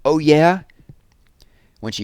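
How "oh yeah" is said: much higher, louder retort; yelling back. Acted. Jessie about to yell back at her brother, much higher and louder. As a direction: snap back with bite. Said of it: "about to yell back something really harsh".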